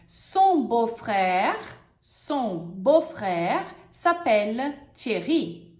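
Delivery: very slow, clear example; teacher models the sentence. Shown as a demonstration. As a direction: model it slowly. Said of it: "por exemplo".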